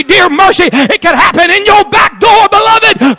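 high, loud shouted warning; preacher thundering judgment; fiery warning preaching. Acted. Sermon climax warning.

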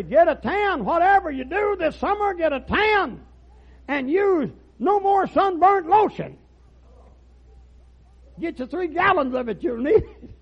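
high, loud hard sell; mock sales pitch. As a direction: holler it like a sun lotion huckster, very high. Acted sun lotion maker's sales pitch.

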